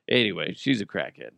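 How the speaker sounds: normal talking voice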